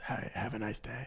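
very soft, low acted reply; hushed little customer-service nicety; meek politeness. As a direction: murmur it politely, barely audible.

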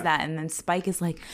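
ordinary talk

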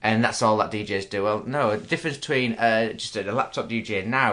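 ordinary talk

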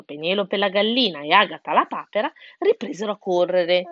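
plain reading voice

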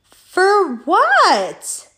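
high, loud "what" in disbelief; mock outraged comeback, pitch leaping up; retold exasperated demand; incredulous retort. Retold incredulous reply to him.

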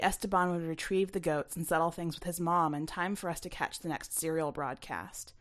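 ordinary delivery, nothing marked

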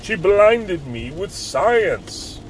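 high, loud, slow mad scientist shout-singing. Retold or imitated. Old man with crazy hair in the video, mad scientist shriek. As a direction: wail it like a crazed old professor. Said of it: "Like a mad scientist".

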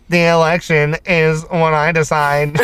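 robotic mock Russian accent; halting Russian-robot accent. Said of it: "somebody to do a Russian accent".

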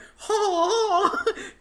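shrill high burst of laughter; high cackle. Real, not acted. Friend cracking up at the hot singles joke, high cackling laugh.

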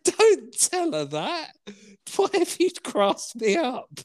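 squeaky high-pitched, slow mock whine. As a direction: push the pitch way up and drag it out. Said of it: "It's like, I went".

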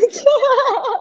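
loud high burst of laughter. Real, not acted. Friends laughing loudly together. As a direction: crack up at the answer.

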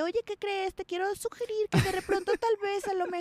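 put-on high, hesitant Mexican-accent politeness; singsong mock Mexican hedging. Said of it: "¿Por qué pones acento".

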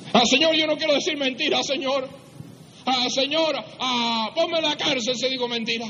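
high-pitched, hammed-up begging; acted desperate prayer. Preacher acting a liar pleading with God, desperate. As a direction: beg in a mock-desperate high voice. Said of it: "a solas con el Señor así".

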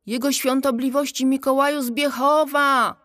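high-pitched ceremonious call. A narrator's reading. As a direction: call the full title out across the water. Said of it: "wołała Pchełka".

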